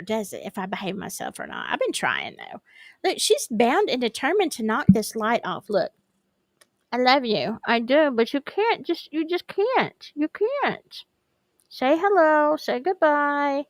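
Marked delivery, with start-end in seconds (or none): 5.7-13.6 pet talk. Real, not acted. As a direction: scold the pet gently, then coo. Talking to pet on stream.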